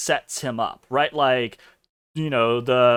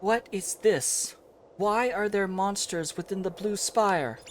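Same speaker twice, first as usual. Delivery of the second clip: perplexed Lucia; 5 semitones higher, 4 dB quieter than usual. Acted. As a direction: question it with formal puzzlement. Lucia voiced confronting monsters.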